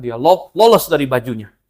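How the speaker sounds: ordinary talk, nothing marked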